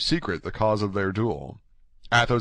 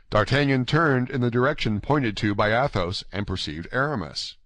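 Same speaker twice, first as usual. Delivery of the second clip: plain reading voice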